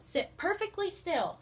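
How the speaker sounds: slow, high stern command; slow, strict order to the class; stern Miss Swamp. A narrator's reading. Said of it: "said Miss Swamp".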